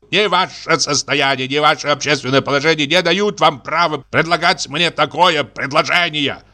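high-pitched, affronted rebuke; indignant refusal. Acted. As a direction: put the upstart suitor in his place. General angrily refuses the suitor.

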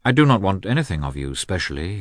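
plain reading voice

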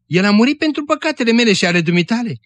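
high, quickened, earnest insistence; fervent urging. A narrator's reading. Woman explains Jesus's death to her old friend, fervent urging. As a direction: press the point quickly, pitched high.

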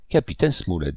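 ordinary delivery

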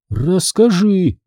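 slow insistent demand. A narrator's reading. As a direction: drag it out, insisting. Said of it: "потребовала Одри".

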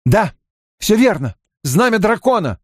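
high-pitched angry exclamations; furious outburst. A narrator's reading. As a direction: let the words burst out in rage. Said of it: "слова неудержимо и яростно рвались с губ". (яростная вспышка)

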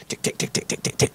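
soft, voiceless ticks in a steady run; imitated coaster clicking. Preacher mimicking the roller coaster going up, ticking sound. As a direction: quietly click like a climbing coaster chain.

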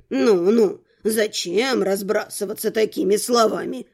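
put-on low, mildly reproving old man; chiding old rector. A narrator's reading. Rector answers the heroine's insult, mildly chiding. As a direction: chide gently in a deep register.

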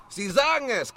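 ordinary talk, nothing marked

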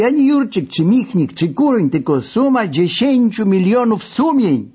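rally them like a speech; declaiming yell. A narrator's reading. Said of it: "wrzasnąłem".

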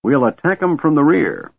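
high, gleeful scheming. A narrator's reading. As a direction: plot the attack with delight. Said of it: "in high glee".

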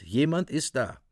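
ordinary talk, nothing marked

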